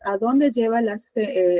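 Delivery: ordinary talk, nothing marked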